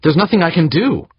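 high, loud whining protest. A narrator's reading. Woman excusing herself to angry crewman, whining. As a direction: whine helplessly, pitch way up. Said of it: "the woman whined".

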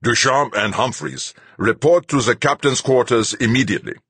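loud, high, blaring PA voice; loudspeaker announcement. Acted. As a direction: bark it through a ship's speaker. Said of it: "loudspeakers blared out".

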